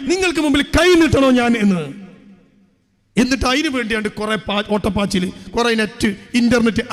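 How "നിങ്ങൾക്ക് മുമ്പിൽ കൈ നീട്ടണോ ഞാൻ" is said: mock shameless wife. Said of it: "ഉളുപ്പില്ലാതെ പറയുക".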